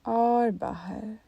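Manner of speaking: very slow, stretched words; drawn-out exhale cue. Shown as a demonstration. Meditation teacher pacing listeners' exhale, slow and drawn out. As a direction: draw it out along the outgoing breath.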